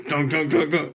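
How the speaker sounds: mock stomping noises; stomping sounds. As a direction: stomp it out like a sulky kid. Stamping back up the stairs.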